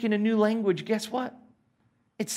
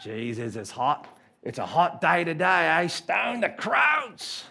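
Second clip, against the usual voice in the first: Australian accent; slower than usual. Shown as a demonstration. Preacher showing off his weird speech, Australian accent. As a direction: grumble about heat in exaggerated Australian. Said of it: "When I talk in Australian".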